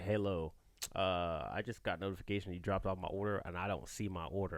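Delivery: lightly voiced caller asking where his food is; imitating the customer. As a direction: drop low and quiet like a bewildered customer on the phone. Customer calling about missing order.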